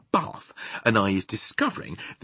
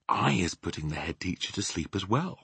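ordinary delivery

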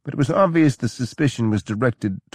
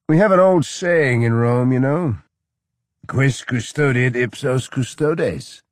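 louder, higher, boozy lecturing; higher, louder drunken counsel; loud drunk advice; 6 semitones higher, 4 dB louder than usual. A narrator's reading. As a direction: play the sloppy drunk giving advice. Drunk general quoting Latin.

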